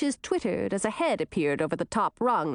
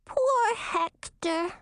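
high, slow little doll voice; too calm creepy doll; 11 semitones higher, much slower than usual. Acted. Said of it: "said the doll".

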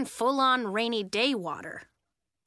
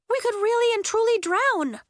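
high, loud cry of fear; frightened, high-pitched emphasis; panicked; 13 semitones higher, 6 dB louder than usual. A narrator's reading. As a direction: escalate into full alarm. Realization grows into panic.

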